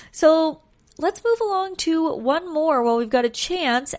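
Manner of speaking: usual speaking voice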